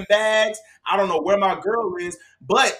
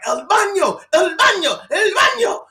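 rising higher and louder with each el baño; guard repeating more insistently; mock guard building; 11 semitones higher, 5 dB louder than usual. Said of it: "He was like".

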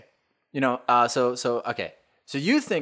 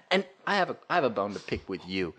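normal talking voice

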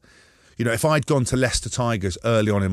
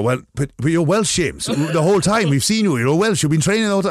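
higher, louder incredulous protest; mock indignant Welsh coach; 6 semitones higher, 5 dB louder than usual. Welsh coach protesting the switch to England, high and loud. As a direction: protest that he's Welsh, pitched up.

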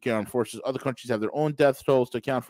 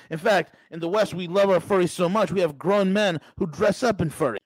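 ordinary talk

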